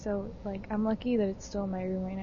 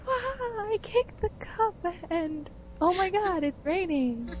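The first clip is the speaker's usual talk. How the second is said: mimicked amazement; 8 semitones higher than usual. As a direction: imitate her wide-eyed triumph turning to shock. Acting out the friend's triumph.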